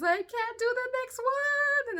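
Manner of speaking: high, loud wail, acted despair; losing it. Mother re-enacting panic at the next contraction, wailing scream. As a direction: build into a hammed-up, high-pitched scream. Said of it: "I was losing it again".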